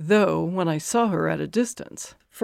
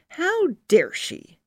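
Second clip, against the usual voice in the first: indignant; 9 semitones higher, slower than usual. A narrator's reading. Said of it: "My green eyes flash with anger".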